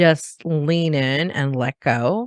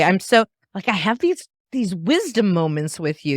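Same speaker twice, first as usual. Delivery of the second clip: usual speaking voice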